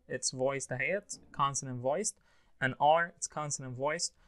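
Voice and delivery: normal talking voice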